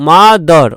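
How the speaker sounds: "মাদার" drawn into two parts, ma-dor; second syllable of "মাদার" with অ, as -dor; o-sound ending. Shown as a demonstration. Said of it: "অ উচ্চারণ করে অনেকে".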